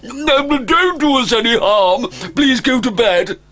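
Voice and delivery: a mother dragon begging a fearsome guest; frightened dragon mother. Acted. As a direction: beg the stranger to leave you be.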